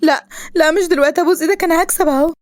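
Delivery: high, urgent heroine's begging; acted high-pitched panic; panicked pleading. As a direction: plead desperately as it goes wrong. Motor fails, heroine begs.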